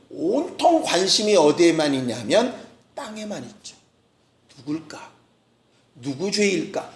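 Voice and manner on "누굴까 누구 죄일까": low, hushed musing, as if to oneself; imitated wondering. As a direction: voice their question softly and low. Voicing the leaders' idle wondering.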